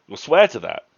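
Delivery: loud high insistent challenge; pressing demand. Acted. Williams demanding Nisbet swear, loud insistent. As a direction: make him commit to it.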